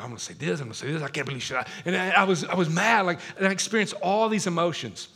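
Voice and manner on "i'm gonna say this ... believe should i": low, soft talking to oneself; inner monologue. Acted. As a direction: act out agitated thoughts under your breath. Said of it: "in my mind, I'm like".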